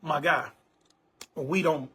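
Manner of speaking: usual speaking voice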